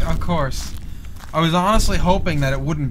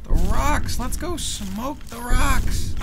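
ordinary talk

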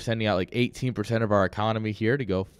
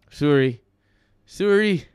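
mock Canadian apology, rounded vowel; badly faked Canadian accent; terrible Canadian accent; 3 semitones higher, 4 dB louder, slower than usual. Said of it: "my terrible Canadian accent".

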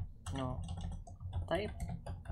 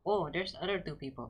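normal talking voice

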